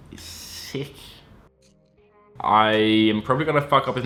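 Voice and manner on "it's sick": whisper. Pretend. Whispered aside after the Aussie bit.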